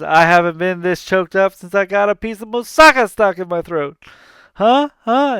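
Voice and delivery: fast villain gag ending in high needy huhs; Hades joke. Retold or imitated. Hades fishing for laughs from the gods, high and pushy. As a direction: push the punchline, then beg for a laugh.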